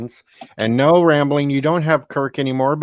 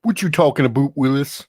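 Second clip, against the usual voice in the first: 1 catchphrase quote. Retold or imitated. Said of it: "quoting a TV catchphrase".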